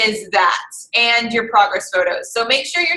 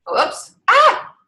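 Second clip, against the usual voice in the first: sudden high-pitched "whoops, ah!"; startled yelp; 11 semitones higher than usual. Real, not acted. Vlogger caught by a mishap mid-explanation, startled yelp. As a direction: let out a surprised little yelp.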